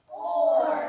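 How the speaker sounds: strong drawn or sound to copy; sound demo. Shown as a demonstration. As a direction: clear loud or sound for the class to echo. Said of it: "Say it with me".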